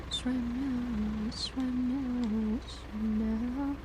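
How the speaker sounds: slow, stretched vowels; slow drawn-out mock drawl; dragged out slowly. Shown as a demonstration. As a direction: draw each word out lazily. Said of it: "I can really go".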